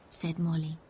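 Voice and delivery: ordinary delivery, nothing marked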